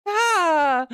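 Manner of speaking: sharp high shriek of fright; startled scream. Acted. Player jumps at the game, startled shriek. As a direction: yelp in sudden fright.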